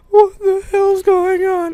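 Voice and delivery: loud, high, drawn-out mock wail; imitated crying. Hurt child after a bike crash, mock crying. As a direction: wail it slowly, like a panicked little kid. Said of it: "I was just crying".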